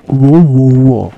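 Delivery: shouted wow; hammed-up shout; loud mock wow. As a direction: bellow out amazement. Loud exclamation.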